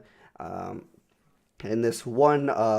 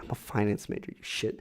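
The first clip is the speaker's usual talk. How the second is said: inner thought; 3 semitones lower, 9 dB quieter than usual. Retold or imitated. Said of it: "in my own head".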